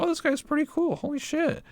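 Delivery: high, soft mock excited voice; lightly voiced starstruck gush; naive delight. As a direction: play your gullible past self. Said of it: "I was like".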